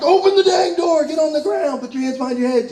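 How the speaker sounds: high, pushy barked orders; mock irritated officer; impatient cop. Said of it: "the cop's like".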